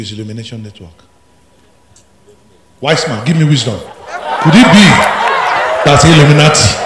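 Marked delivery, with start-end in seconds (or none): 2.8-6.9 loud laughing scorn; mocking laugh. Real, not acted. Pastor mocking critics who called him Illuminati, laughing loudly.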